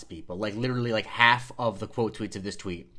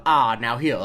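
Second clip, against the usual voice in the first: slow Southern drawl; 7 dB louder, slower than usual. Retold or imitated. Imitating the film's tobacco CEO, slow Southern drawl. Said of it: "he has a slower way of speaking".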